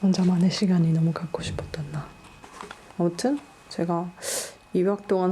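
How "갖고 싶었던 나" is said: soft unvoiced whisper. Real, not acted. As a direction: breathe it out as a private aside. Whispered caption-like aside.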